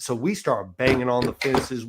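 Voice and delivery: ordinary delivery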